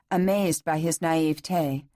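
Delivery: usual speaking voice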